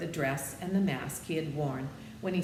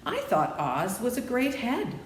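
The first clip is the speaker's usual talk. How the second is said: put-on light, slightly higher girl's voice; a hint of young Dorothy; 8 semitones higher, 5 dB louder than usual. Dorothy tells the humbug what she expected. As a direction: lift the voice a little for a young girl.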